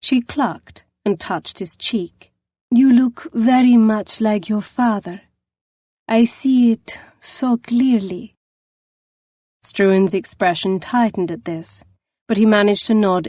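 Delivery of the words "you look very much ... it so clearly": put-on eager, heartfelt, in a rolling foreign accent; Dowager's rolling accent. A narrator's reading. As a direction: welcome him as family in a rolling foreign accent. Dowager touches his cheek.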